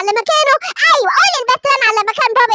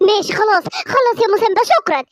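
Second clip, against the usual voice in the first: ordinary talk, nothing marked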